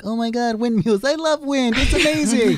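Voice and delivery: high, gushing child impression; mock childish wonder; excited ten-year-old. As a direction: gush like a kid thrilled by windmills. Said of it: "I could see my ten-year-old cousin saying".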